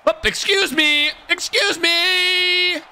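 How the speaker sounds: mock polite. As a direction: feign offended politeness.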